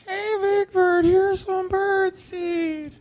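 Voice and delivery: very high, slow, strained squeak; squeaky nervous kid. Shown as a demonstration. A terrified child actor squeaking his one line to Big Bird. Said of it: "the way it came out was more like".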